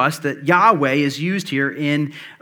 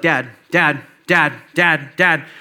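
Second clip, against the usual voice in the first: a light mock of kids calling dad over and over, a touch louder; 3 dB louder, slower than usual. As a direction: nag dad lightly like an impatient child. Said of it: "it so often is like".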